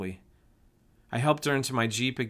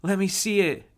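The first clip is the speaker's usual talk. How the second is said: high, loud eager yell; 10 semitones higher, 6 dB louder than usual. A narrator's reading. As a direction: yell it while running over. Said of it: "Josie yelled".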